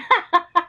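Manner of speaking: a sudden high laugh bursting out; burst of laughter. Real, not acted. Laughing at her mum's reaction.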